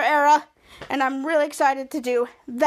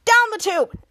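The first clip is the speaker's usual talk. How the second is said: louder, higher mad yell; angry shout; 6 semitones higher, 5 dB louder than usual. Retold or imitated. Mika, furious at Ray, yells as she leaves in a loud mad yell. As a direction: storm off with it. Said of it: "Mika's so mad".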